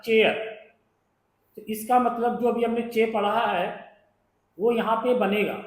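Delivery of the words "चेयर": चेयर with a clear ch start, as "chair"; example word. Shown as a demonstration. Teacher giving students the word chair as an example, clearly. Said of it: "चे पढ़ना है".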